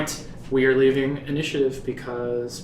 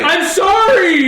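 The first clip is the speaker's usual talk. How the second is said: high, loud, slow frightened yell; panicked apology shout; 13 semitones higher, 7 dB louder, slower than usual. Acted. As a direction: blurt the apology in terror. NPC forced to apologize.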